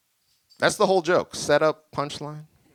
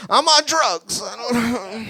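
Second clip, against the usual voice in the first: much higher, louder panicked confession; high squeaky blurt; 11 semitones higher, 4 dB louder, slower than usual. Retold or imitated. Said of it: "I just went".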